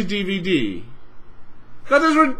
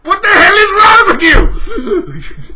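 slow, louder, much higher bellow; furious shout; 9 semitones higher, 9 dB louder, slower than usual. Real, not acted. Raging at Microsoft at the rant's peak, furious shout. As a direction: roar it at them.

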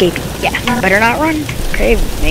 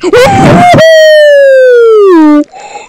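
sharp scared gasp; loud terrified gasp; 9 dB louder than usual. Real, not acted. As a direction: gasp in sudden terror. Jump scare in horror game.

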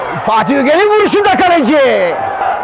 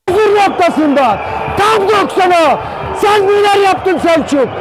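screaming football commentator; 11 semitones higher than usual. Real, not acted. Goal call in live commentary.

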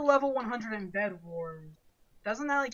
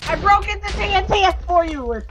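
panicking gamer; 6 semitones higher, 6 dB louder than usual. Real, not acted. Panicked teammate in fight.

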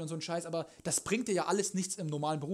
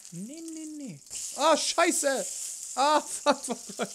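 high, loud panicked cursing; something going wrong on the stove; 8 semitones higher, 4 dB louder, much slower than usual. Real, not acted. Cooking mishap.

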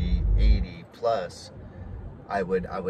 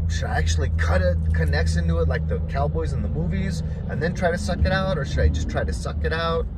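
higher and louder, frantic second-guessing; panicked inner voice; 8 semitones higher, 5 dB louder than usual. Retold or imitated. Telling how he panicked over the snakebite, frantic and higher. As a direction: blurt it out in a panic, pitched up. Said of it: "And so I'm like".

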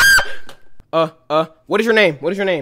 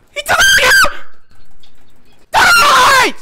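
very high shrieked words; terrified scream; slower than usual. Real, not acted. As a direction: shriek in real fright. Screaming at a scare.

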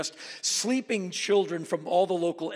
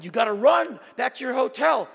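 urgent; 8 semitones higher, 8 dB louder than usual. Retold or imitated. Comic urgency fleeing mosquitoes.

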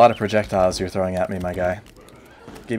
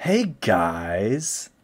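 over-the-top YouTuber greeting; hyped-up hey guys; clickbait video narrator. Shown as a demonstration. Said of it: "the YouTuber voice".

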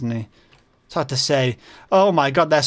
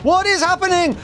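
panicked repeat; 10 semitones higher than usual. Real, not acted. Overwhelmed gamer repeats the question in rising panic. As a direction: push the repeat up into alarm. Monsters swarm.

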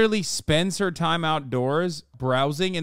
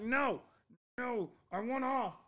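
high, soft little pet voice; voicing the dog; 10 semitones higher, 9 dB quieter than usual. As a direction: plead softly as the dog, pitched way up. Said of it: "That's what she's saying".

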